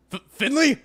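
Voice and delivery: high, startled stammer; surprised stutter. Acted. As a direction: caught off guard.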